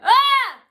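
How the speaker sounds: loud, high mimicked shout; imitated one-syllable yell. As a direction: blurt a wordless yell like a passing driver. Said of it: "a one-syllable word fr-, from a girl going".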